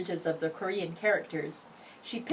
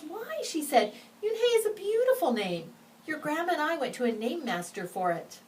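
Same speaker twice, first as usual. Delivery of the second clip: surprised mother; 10 semitones higher than usual. Acted. Mother surprised, defending name.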